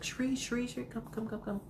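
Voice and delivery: soft, high-pitched pet-calling voice; calling the cat. Retold or imitated. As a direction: coax a cat closer, high and hushed. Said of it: "she's calling the cat".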